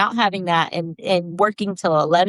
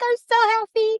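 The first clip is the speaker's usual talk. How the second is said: high-pitched happy gush; imitated delight. Client greeting the host at the party, imitated delight. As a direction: echo the thrilled client up high.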